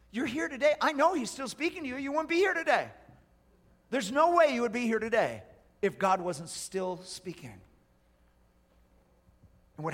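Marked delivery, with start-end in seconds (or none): 0.1-7.5 high, loud, driving sermon delivery; full-force preaching. Real, not acted. Preacher warning those not listening, forceful. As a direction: preach it out to the whole room.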